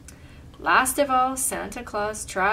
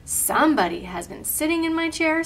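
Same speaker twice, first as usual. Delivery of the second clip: lightly voiced little bear, pitched up; a hint of a higher baby-bear voice; wee little Baby Bear voice; 6 semitones higher, 3 dB louder than usual. A narrator's reading. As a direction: complain as a small storybook bear. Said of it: "in his wee little voice".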